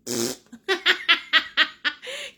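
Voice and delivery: real uncontrollable laughing fit; helpless laughter. Real, not acted. Streamer after a viewer begs her to stop, helpless laughter. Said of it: "I can't stop laughing".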